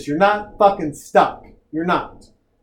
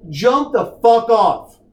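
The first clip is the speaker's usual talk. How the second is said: normal talking voice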